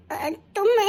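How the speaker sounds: ordinary talk, nothing marked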